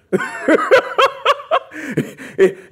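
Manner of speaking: long high-pitched laugh; high burst of laughter. Retold or imitated. Man laughing as he recalls getting invited, high laughter.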